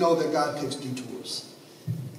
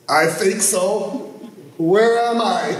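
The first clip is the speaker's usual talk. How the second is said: slow, louder, higher worried answer; lost and scared; 4 semitones higher, 5 dB louder, slower than usual. Retold or imitated. Preacher answering the radio voice, slow and scared. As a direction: plead for bearings.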